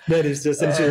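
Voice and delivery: laughing in disbelief. Real, not acted. Pizza with pineapple joke.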